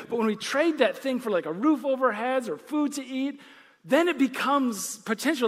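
fervent preacher in full flow; preaching. Acted. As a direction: build it up like a pulpit appeal. Preaching builds to full force.

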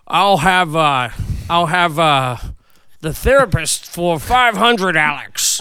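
loud, slow Connery voice; a hammed-up Sean Connery on a quiz show; Sean Connery impression. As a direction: lean into that Connery slur. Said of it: "Sean Connery".

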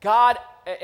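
ordinary delivery

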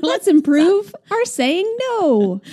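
slow, high, playful drawl; drawn out. Acted. Host teasing co-host, slow playful drawl. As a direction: stretch it out like a joke.

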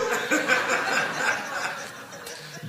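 real voiceless laughter; can't hold it in; breathy laugh. Real, not acted. Laughing after punchline.

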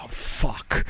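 low, breathed-out curse of resignation; whispered dread. Acted. Listener trapped by a long story, whispered in dread. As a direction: curse under your breath.

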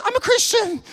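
very high, jubilant cry; excited declaration. Retold or imitated. Convert declaring his faith to the preacher, very excited. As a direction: imitate a thrilled convert proclaiming it. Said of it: "he's so excited".

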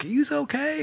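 high mock worried question; imitating anxious crew; concerned crew. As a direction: lift it way up, worried. Crew asking during blow-up.

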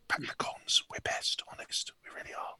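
whispered sinister villain aside; evil Bond villain. Acted. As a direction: breathe it like a scheming Bond villain plotting. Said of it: "in an evil voice while, while stroking my cat in the style of a James Bond villain".

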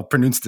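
pronounced quoted as pro-NOONST; mispronounced. Real, not acted. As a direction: say "pronounced" with OO as in "moon". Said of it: "is not a real way to pronounce that word".

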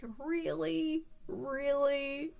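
high, soft disbelieving really; drawn-out incredulous question. Real, not acted. Fan mocking the plot with soft incredulous disbelief.

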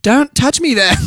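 put-on very high, slow, stretched Aussie accent; Australian girl. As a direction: protest like a hammed-up Australian girl. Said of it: "Every Australian girl".

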